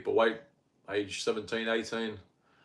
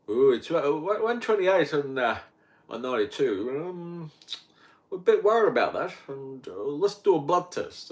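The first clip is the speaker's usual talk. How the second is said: higher, louder worried GP; concerned doctor; 5 semitones higher, 3 dB louder than usual. Retold or imitated. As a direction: play the fussing doctor. Doctor reads blood pressure.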